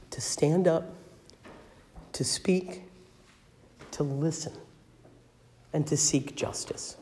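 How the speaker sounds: hushed, breathy call to act; whispered emphasis. Real, not acted. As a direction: whisper it to draw them close. Preacher drops to whisper for emphasis.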